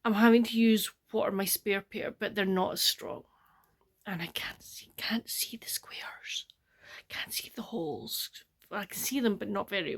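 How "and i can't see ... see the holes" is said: breathy whisper, drawn out and slow; whispered frustration. Real, not acted. Alone at the craft table, unable to see the squares, whispering in frustration. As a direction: mutter it under the breath, squinting at the stitches. Straining to see without glasses.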